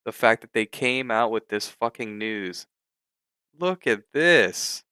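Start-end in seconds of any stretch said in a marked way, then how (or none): 3.6-4.8 angry. Real, not acted. Trader ranting at crashing platform.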